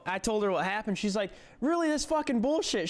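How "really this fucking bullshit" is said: high, incredulous angry coworker; mock outrage. Coworker fuming over his firing, high incredulous outrage. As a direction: huff it out in disbelief.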